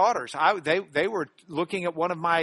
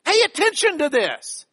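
higher and louder, pressing each word; emphatic call for attention; 7 semitones higher, 5 dB louder than usual. Shown as a demonstration. Teacher quoting a Bible reader who breaks in, loud and emphatic. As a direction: call it out to make them look. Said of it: "someone just said".

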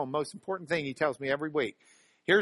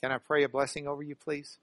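ordinary delivery

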